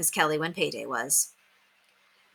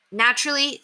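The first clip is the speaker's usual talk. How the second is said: ordinary delivery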